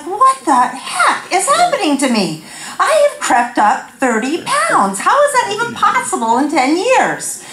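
high-pitched, disbelieving complaint; comic exasperation. Retold or imitated. Woman to doctor about weight gain, comic exasperation. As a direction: wail it in mock dismay.

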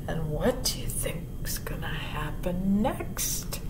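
low, whispery question; hushed. A narrator's reading. Storyteller asking listeners what happens next, hushed. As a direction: draw listeners in with a soft whisper.

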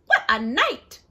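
lightly voiced mole, muttered; a hint of a grumbling mole; muttering mole. A narrator's reading. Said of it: "Mole mutters".

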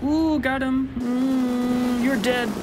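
excited taunting. Real, not acted. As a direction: crow over the kill.

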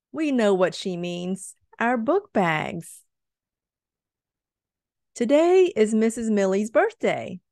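lively storybook reading; picture book read-aloud voice; reading to children. A narrator's reading. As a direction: read it to a class of small kids.

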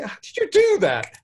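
high, astonished doctor voice; impressed disbelief from the doctors; amazed question. Retold or imitated.

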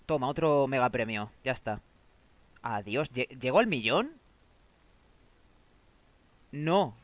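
ordinary delivery

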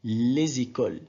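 "Les écoles" joined by a Z; liaison. Shown as a demonstration. Teacher repeating the example, liaison Z in "Les écoles". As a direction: say "Les écoles" as one word, Z in the middle. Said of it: "la liaison".

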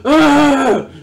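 high-pitched frustrated shriek; angry scream. Real, not acted. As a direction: let out a scream of rage. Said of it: "I'm genuinely angry".

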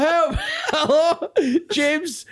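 high mock cry for help through laughter; imitated trapped man's plea; retold shouting. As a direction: call out high, half laughing. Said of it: "I remember I was shouting".

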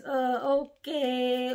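slow, grudging "uh, okay"; reluctant okay. Retold or imitated. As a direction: drag it out, half-hearted. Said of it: "you could hear it in his voice".

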